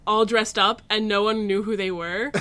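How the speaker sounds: ordinary talk, nothing marked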